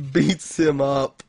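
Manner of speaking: usual speaking voice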